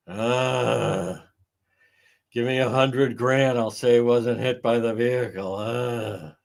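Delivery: hesitant mimicry; mock witness. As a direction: stammer like a dim witness. Mocking the same witness.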